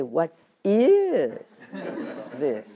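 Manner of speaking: emphasis placed on "is"; stress on is. Shown as a demonstration. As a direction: punch the word "is". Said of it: "Or should I say".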